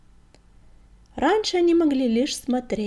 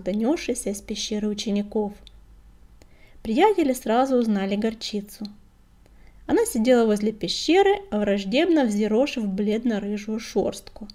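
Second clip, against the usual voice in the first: ordinary delivery, nothing marked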